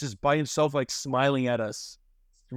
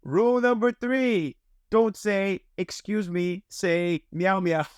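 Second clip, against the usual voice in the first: imitating the maid; 5 semitones higher, much slower than usual. Maid explains cafe rules to guests, high and slow. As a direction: mimic the maid spelling out rules, pitched well up. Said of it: "It's exactly how she sounds".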